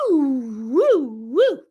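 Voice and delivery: loud high whooping woo sounds; hormone swings woo. Shown as a demonstration. Doctor acting out her hormones going up and down, loud whooping. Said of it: "her hormones are, like, going".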